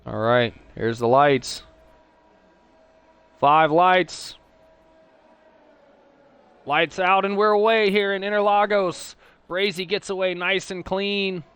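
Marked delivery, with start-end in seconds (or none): 3.4-4.4 rising start call. Real, not acted. Race start countdown. 6.7-9.2 higher, louder excited race-start call; lights out call. Real, not acted. Commentator answers a viewer's request with a loud, high excited race-start call. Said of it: "Wraith making a request".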